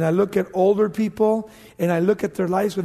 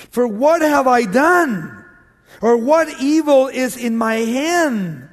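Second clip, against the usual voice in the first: loud, slow, pleading questions; David calls out his innocence to the king; 4 semitones higher, 5 dB louder, slower than usual. Acted. A loud, slow plea.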